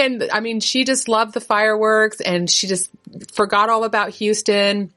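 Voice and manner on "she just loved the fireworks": ordinary talk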